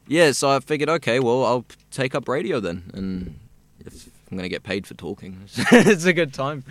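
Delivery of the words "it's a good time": slow and laughing, voice up high; amused, laughing. Real, not acted. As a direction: chuckle it out slowly. Laughs about getting paid to talk.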